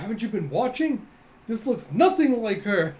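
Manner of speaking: high, loud indignant outburst; acted exasperated scolding. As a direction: berate them with heavy punch. Acted boss berating.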